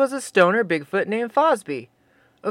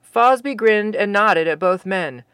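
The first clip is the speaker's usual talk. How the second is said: ordinary delivery, nothing marked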